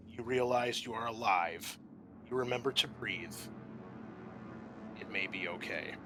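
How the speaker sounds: usual speaking voice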